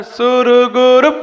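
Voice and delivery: सुरगुरु stretched slowly with a rise; drawn-out suruguru. Shown as a demonstration. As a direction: draw out सुरगुरु. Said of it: "अब यहाँ पे क्या बोलना है". (सुरगुरु खींचकर)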